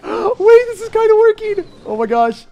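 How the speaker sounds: very high, loud disbelief; gasp, then squeal in astonishment; real squeaky excited amazement; amazed outburst. Real, not acted. Amazed the plan is working.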